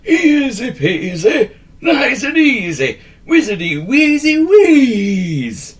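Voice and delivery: high, loud, slow chanted spell; magic words. A narrator's reading. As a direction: intone the spell with relish. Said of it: "said his famous magic words".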